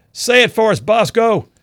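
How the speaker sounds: high, loud call across the ranks; yelling. Retold or imitated. Squad mate yelling to Bosco for his catchphrase, yelling. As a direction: shout it out as a cheerful demand. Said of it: "Somebody would always yell".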